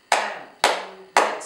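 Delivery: AMBULANCE slowly split into three beats, am - bu - lance; syllable by syllable. Shown as a demonstration. Teacher doing a word with clapping, AMBULANCE in three separate beats. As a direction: clap out AMBULANCE, one slow syllable per clap. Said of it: "do ambulance with clapping".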